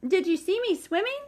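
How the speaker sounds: high squeaky excited voice; excited squeak. A narrator's reading. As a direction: squeak it proudly. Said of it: "squeaked Roo excitedly".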